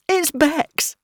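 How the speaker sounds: high, tearful wail; sobbing. A narrator's reading. Distraught friend naming the foster child, sobbing. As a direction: say it through a sob. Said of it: "She gave another sob".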